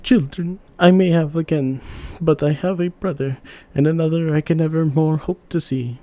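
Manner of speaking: put-on a king sacrificing his children for his brother; resolute king. A narrator's reading.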